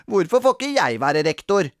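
put-on aggrieved childish complaint; sulky. A narrator's reading. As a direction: ask it like a sulking child. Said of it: "spurte Sara surt".

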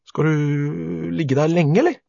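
high, slow, drawn-out question; hesitant boy. A narrator's reading. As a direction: drag out the start, asking cautiously. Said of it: "spurte Bendik".

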